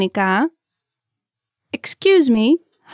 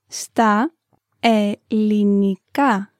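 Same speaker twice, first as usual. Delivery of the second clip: slow; much slower than usual. Shown as a demonstration. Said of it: "repetition drill".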